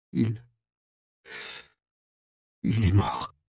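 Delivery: barely able to say it through tears; choked with tears. Acted. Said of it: "d'une voix étouffée par les pleurs". (voix étouffée de sanglots)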